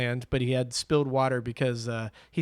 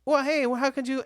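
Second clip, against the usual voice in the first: much higher, indignant whine of the dumped dance partner; imitating the guy; 11 semitones higher than usual. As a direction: squeak it out, wounded and affronted. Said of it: "And he goes".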